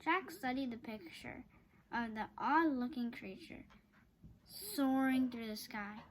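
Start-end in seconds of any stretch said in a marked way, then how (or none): none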